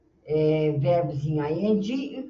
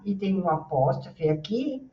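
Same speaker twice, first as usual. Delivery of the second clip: ordinary talk, nothing marked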